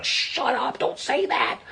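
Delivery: mock-panicked hushing, pitched high; Ryan. Party leader hushing a colleague on tape, mock panic.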